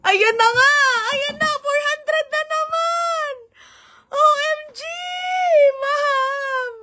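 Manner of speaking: high, thrilled calls at the new gift; real delight, squealing at the screen; overjoyed squeal; excited. Real, not acted. Big gift arrives on stream.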